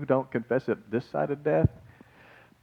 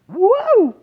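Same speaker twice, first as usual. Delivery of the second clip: high, loud mock whoop; sudden high-pitched cry of amazement; excited whoop; 9 dB louder than usual. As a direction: whoop it out like a thrilled unborn baby. Acts out unborn John's leap.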